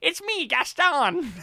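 high, slow comic proclamation; drawn-out silly character voice; Paul Giamatti impression. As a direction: announce yourself in a hammed-up high voice. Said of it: "I would love Paul Giamatti to be".

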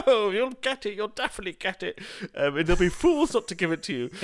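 big, high, gushing celebrity voice; Christopher Biggins. Retold or imitated. Friend retelling Biggins praising their pitch, high theatrical gush. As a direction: imitate him loudly showering them with encouragement. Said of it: "I can't do a Biggins voice".

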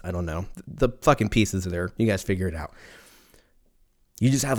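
ordinary talk

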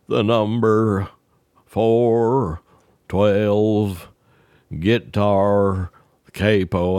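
each word spaced out with pauses; slow repeat. Retold or imitated. Ned repeating the order slowly for Earl. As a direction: spell out "four twelve guitar capo" word by word, very slow.

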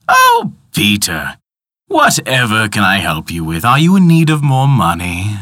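oily, fawning villain; sly clockmaker voice. Acted. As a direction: purr it like a scheming tinkerer. Tick-tock man speaks to Peter.

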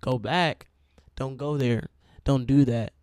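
loud, urgent commands; urgent warning. Acted. Holy Spirit warning a driver to turn back, loud and urgent. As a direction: call out like a voice stopping you in your tracks. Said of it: "you hear the Holy Spirit saying".

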